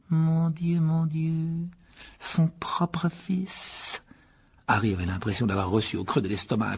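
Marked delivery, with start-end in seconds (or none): 0.1-4.0 aigu, très lent, moqueur; fausse consternation. A narrator's reading. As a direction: feindre l'indignation. Moquerie cruelle du professeur. (consternation moqueuse)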